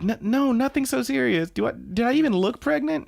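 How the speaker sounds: high stammering protest; hammed-up high exasperation; flustered denial. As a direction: stumble in, then squeak indignantly. Flustered character denies pregnancy.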